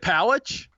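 Palich with a CH at the end; mispronounced surname. Real, not acted. Host guesses at co-host's surname, mispronouncing it as Palich. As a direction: say Palich, A as in pal, ending in CH. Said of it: "No, it's, it's Pollock".

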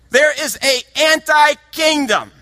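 sudden loud, high shout; preaching shout. Real, not acted. Preacher shouting to the congregation about the anti-kingdom, loud and high.

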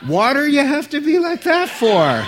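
high, loud, playful pun shout; punning shout. Acted. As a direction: belt the pun out to get a groan.

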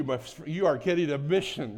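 normal talking voice

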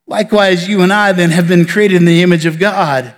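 normal talking voice

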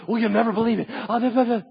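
high, loud gushing reply; mock excitement. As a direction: babble it in hammed-up excitement. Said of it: "And we get all excited".

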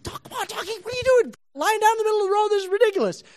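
very high, squeaky complaint; squeaky exasperated voice. Acted. Balaam scolding the lying donkey, very high squeaky voice. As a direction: squeal it like a fussy cartoon rider. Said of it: "She's like".

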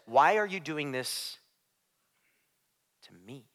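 low, soft, slow question with a long pause before "me"; hushed question. Retold or imitated. Preacher voices Jesus confronting Saul, low and hushed. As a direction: let "to me" sink almost to nothing. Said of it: "He says".

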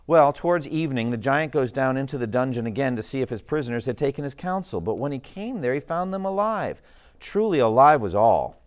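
story reading. A narrator's reading. Reads narration of the giant.

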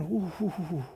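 mock wary oohs. Mocking wary churchgoer.

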